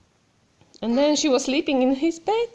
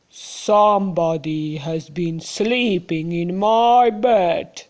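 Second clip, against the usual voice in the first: Papa Bear; 7 semitones lower, 3 dB louder, slower than usual. Acted. Papa Bear voiced in story.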